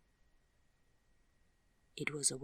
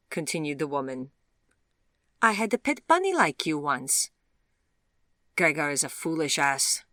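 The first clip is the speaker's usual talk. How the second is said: glamorous stranger mocking Vivian, foreign accent; condescending foreign woman; 8 dB louder than usual. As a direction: purr down at her like a bored aristocrat.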